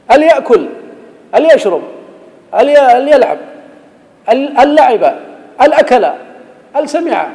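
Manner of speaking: high, loud, incredulous quiz questions; mocking absurd question. Shown as a demonstration. Teacher mocking students with impossible examples, high and loud. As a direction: ask each one high and loud as if absurd. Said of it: "teacher mock questions".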